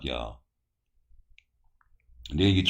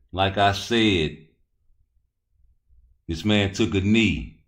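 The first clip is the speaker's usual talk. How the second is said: ordinary talk, nothing marked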